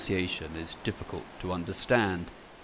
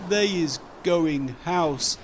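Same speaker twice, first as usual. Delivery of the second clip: sad thought; 8 semitones higher, 6 dB louder than usual. Acted. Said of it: "He thinks sadly".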